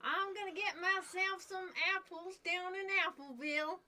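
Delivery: Southern American twang as a cartoon pony; American Southern accent, playful cartoon cowgirl; Applejack impression. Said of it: "there's a My Little Pony Applejack".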